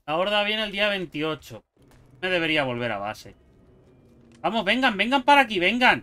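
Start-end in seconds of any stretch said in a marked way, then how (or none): 4.4-6.0 loud, higher taunting call to the horde; louder, higher call: come on, come here. Real, not acted. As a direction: shout them over to you, daring them. Calling zombies to come.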